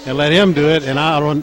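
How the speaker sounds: ordinary talk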